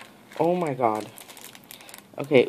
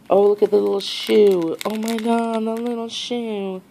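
high, delighted cooing; thrilled gushing; 6 semitones higher, 4 dB louder than usual. Real, not acted. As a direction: gush over something adorably tiny. Thrilled by a tiny shoe.

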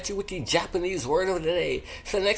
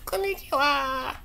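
high voice; 9 semitones higher than usual. Acted. Playful repeated greeting, put-on voice.

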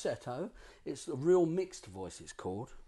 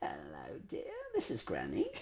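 put-on low soft old granny greeting; 13 semitones lower, 10 dB quieter than usual. Shown as a demonstration. Student asks coach for his granny voice. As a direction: croak it like a little granny. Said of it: "do the granny voice".

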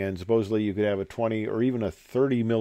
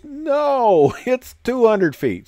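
high, laughing "no" to a student; laughing correction; 13 semitones higher, 5 dB louder, much slower than usual. Retold or imitated. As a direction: correct him while cracking up. Instructor corrects the pilot, laughing.

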